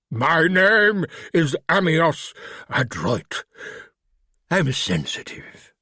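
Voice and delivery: put-on slow, careful refined British accent; lightly voiced refined British creature. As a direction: speak like a polite English gentleman in a monster's body. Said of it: "with a refined British accent".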